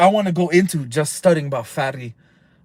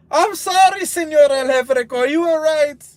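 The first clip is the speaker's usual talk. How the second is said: much higher, louder mock pleading; hammed-up sorry to the boss; pleading apology; 8 semitones higher, 4 dB louder than usual. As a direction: beg forgiveness in a high voice. Imagined sick drinker apologizing.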